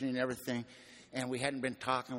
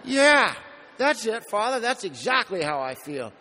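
emphatic yes; 8 semitones higher, 9 dB louder than usual. Retold or imitated. Agreeing loudly with the priest.

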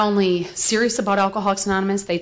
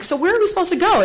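higher, fast baffled question; 9 semitones higher, faster than usual. Retold or imitated. Asking the boyfriend where to go, higher and quick. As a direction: toss it out, puzzled and quick. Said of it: "and I'm like".

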